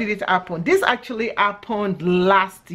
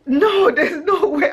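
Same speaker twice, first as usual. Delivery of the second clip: higher, giggling no way; laughing refusal; 8 semitones higher than usual. Retold or imitated. Turning down the guy's hook-up offer, laughing. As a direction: laugh through the no. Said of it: "I was laughing".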